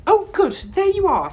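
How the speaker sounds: very high, loud, flustered greeting; breathless. A narrator's reading. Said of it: "She sounded breathless".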